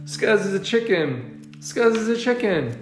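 high singsong jeer; taunting. Acted. Gang keeps teasing the cub, singsong taunting. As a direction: repeat it as a playground chant. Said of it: "taunted the gang".

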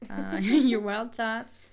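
reading through laughter; giggling over the words; laughing. Real, not acted.

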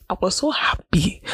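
breathy whispered aside. Real, not acted. The vlogger whispers her relief at leaving school. Repeats the phrase, then whispers it.

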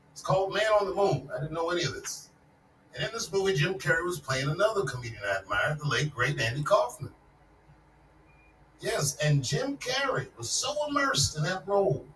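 ordinary talk, nothing marked

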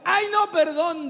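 high, drawn-out mock dismay; flustered self-correction. Woman catching her mistake mid-prophecy, high and flustered. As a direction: cry out as the blunder dawns on you.

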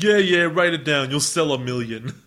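mock them with sass; sarcastic train. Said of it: "the train's like".

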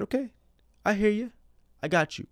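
high-pitched, lightly voiced God. God answers the speaker's promise, high-pitched reply. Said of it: "God said".

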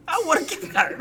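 high, loud insistent whine; whiny demand. Real, not acted. Repeated louder insisting.

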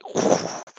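airy whoosh of blowing wind; breeze sound. Shown as a demonstration. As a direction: blow softly like cloth caught in a breeze. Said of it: "like blowing in the breeze".

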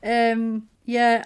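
normal talking voice

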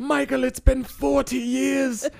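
high-pitched pleading doctor; Doctor Loomis voice. Retold or imitated. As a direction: beg him like an exasperated doctor. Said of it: "Doctor Loomis suddenly made an appearance".

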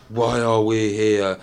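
very slow, drawn-out tipsy question; imitated drunk. Newbie retelling his late-night post, imitated drunk. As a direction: drag it out like a drunk philosopher. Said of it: "I was so drunk".

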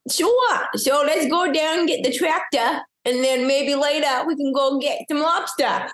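high, put-on Maynard accent. At dinner, showing two strangers a Maynard accent. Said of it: "I said it just like that".

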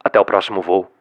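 deep, steady cabin announcement; airline pilot. Shown as a demonstration. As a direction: speak like the captain on the intercom. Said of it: "com voz de piloto".

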